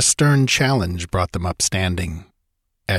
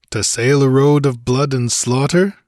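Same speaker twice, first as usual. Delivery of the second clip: questioning; 5 semitones higher, 4 dB louder than usual. Acted. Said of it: "he questioned".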